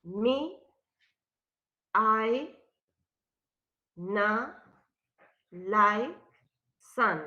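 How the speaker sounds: stressed syllables called out one by one, loud and apart; only the stresses. Shown as a demonstration. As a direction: punch each beat alone: Me, I, na, like, sun. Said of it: "¿dónde irían los stresses?".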